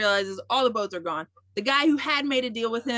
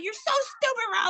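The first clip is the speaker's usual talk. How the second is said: mock Jack; 11 semitones higher than usual. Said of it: "this is where he's so stupid".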